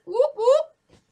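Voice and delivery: high, loud whoop whoop; excited cheering about subscribers. Real, not acted. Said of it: "celebrating subscribers".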